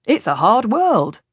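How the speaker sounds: breezy and untroubled, stretching the words; cheerful. A narrator's reading. Mercenary shrugs off the priest, loudly cheerful. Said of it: "the man said cheerfully".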